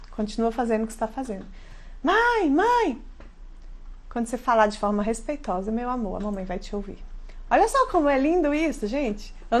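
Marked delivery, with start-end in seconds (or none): 2.0-3.0 put-on high, whiny kid calling mom; mock child whining. Child voiced in example. 4.1-7.0 calm gentle mom. Shown as a demonstration. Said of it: "mom's calm answer demo".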